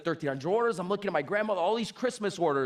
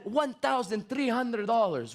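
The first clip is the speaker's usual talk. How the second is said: put-on slow, higher nonna voice with Italian accent; Italian grandmother; 4 semitones higher, slower than usual. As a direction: spell out the sum like a proud Italian grandma. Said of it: "She said, she said".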